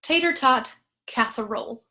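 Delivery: tater tot casserole in clear beats; rhythmic syllables. Shown as a demonstration. Music teacher showing word rhythm. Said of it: "But for the rhythms".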